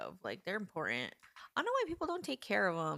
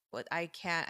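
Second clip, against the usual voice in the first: usual speaking voice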